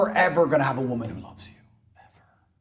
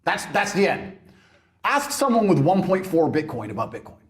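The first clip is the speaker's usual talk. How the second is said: ordinary talk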